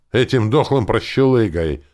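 lively mocking cheer; cheerful reply. A narrator's reading. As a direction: say it with gleeful relish. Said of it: "весело ответил друид".

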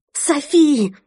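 high, loud alarmed call of a name; urgent call. Acted. Sister bursts in calling out.

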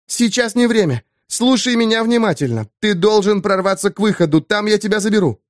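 lightly voiced woman, firm and quick; Eva's voice. A narrator's reading. Eva's voice in his head.

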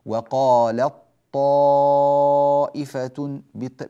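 "وقالت طائفة" with the ta swallowed into a heavy doubled "طـ"; slow Quranic chant of "وقالت طائفة"; recited assimilation. Shown as a demonstration. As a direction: draw out "وقالت طائفة" slowly, tajweed style. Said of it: "بطاء تام".

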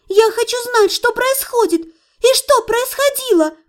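high, agitated insistence; insistent demand. Acted. Patient wants to know what happened, high insistent protest. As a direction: demand in a high voice, drawing out the end.

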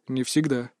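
low, softened gravity; serious objection. A narrator's reading. Father gently contradicting son, serious and subdued. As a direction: answer soberly, soft and low. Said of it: "серьёзно возразил отец".

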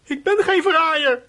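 high, loud, slow protest; indignant denial. Acted. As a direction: deny it hard. Boy denies accusation hotly. (verontwaardigd en luid ontkennend)

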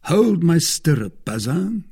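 mild call, pitched high; crying out. A narrator's reading. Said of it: "cried Aramis".

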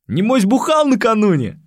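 loud, high cheeky pupil; cheeky shout. Acted. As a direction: blurt it out brashly. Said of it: "с гоготом высказал".

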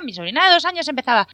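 usual speaking voice

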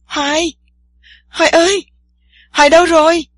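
put-on alarmed girl screams for her missing friend in the water; frantic yelling. A narrator's reading. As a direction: yell for him in rising panic. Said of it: "khiến Tiên Sa la làng".

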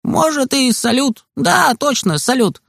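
put-on high, far boyish voice, easygoing; Kostik's voice. A narrator's reading. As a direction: lift into a carefree kid friend. Kostik's voice in story.

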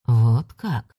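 low, drawn-out and doubtful; drawn-out disbelief. Acted. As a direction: stretch it out, unconvinced. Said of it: "недоверчиво протянул он".

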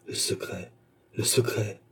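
ordinary delivery

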